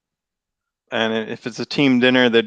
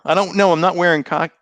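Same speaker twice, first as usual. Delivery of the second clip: ordinary talk, nothing marked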